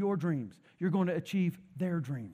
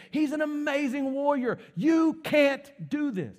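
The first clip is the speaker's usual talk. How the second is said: high, insistent royal put-down; forceful dismissal; 6 semitones higher than usual. Acted. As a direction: argue the boy down, pitch raised throughout. King Saul dismisses young David.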